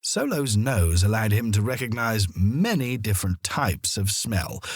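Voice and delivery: ordinary delivery, nothing marked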